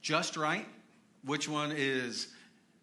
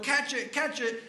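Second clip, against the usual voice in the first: high, loud urgent call of catch it; retold yell; 11 semitones higher, 4 dB louder than usual. Sam yelling down to Frodo, high and loud. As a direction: shout it down the cliff to a friend. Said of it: "he yells down to Frodo".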